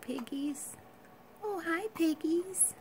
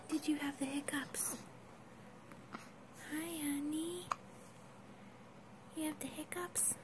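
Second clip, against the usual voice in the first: whispering; 9 dB quieter than usual. Real, not acted. Cooing over baby.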